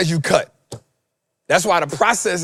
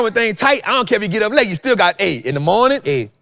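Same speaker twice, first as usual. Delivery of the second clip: ordinary talk, nothing marked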